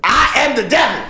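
loud, high hammed-up devil boast; mock devil. Cohost declaring himself the devil, loud mock boast. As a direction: shout it like a cartoon devil.

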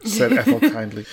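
reading through laughter; laughing. Real, not acted. Reader cracking up mid-sentence while reading aloud. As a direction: giggle breaking into the words.

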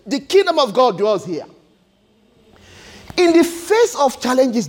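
ordinary delivery

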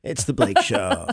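vocal fry. Pretend. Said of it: "I'm gonna do that vocal fry thing".

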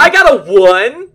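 dismayed loud. Real, not acted. Player reports a bad roll.

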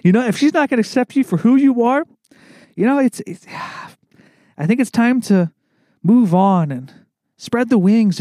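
high, earnest mock-counseling voice; Brittany. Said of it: "It'd be like my coworker, Brittany".

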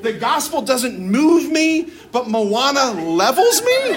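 incredulous outburst. Retold or imitated. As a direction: build to a comic, exasperated question. Retold incredulous complaint to director.

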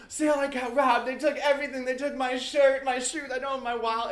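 high, loud mock sobbing plea; fake crying. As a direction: play up a fake robbery in tears. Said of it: "pretending to cry".

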